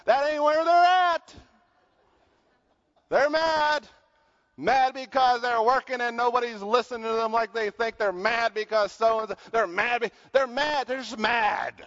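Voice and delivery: preaching at full force. Real, not acted.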